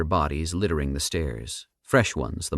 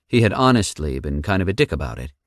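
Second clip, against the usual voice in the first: ordinary delivery, nothing marked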